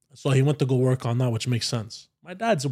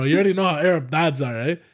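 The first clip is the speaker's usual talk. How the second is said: ordinary talk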